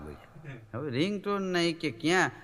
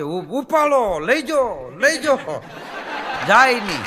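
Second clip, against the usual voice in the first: loud, higher nagging pick-up call; insistent ringtone voice; 4 semitones higher, 5 dB louder, slower than usual. Retold or imitated. A ringtone voice nagging the owner to pick up, loud and high. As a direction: nag someone to answer the phone.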